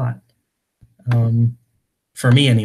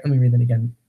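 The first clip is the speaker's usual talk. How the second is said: normal talking voice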